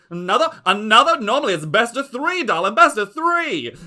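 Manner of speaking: high, loud gushing diva; gloating aunt voice. Acted. Lady Ziza taunting the player at the dice table, high gushing diva. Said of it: "She goes".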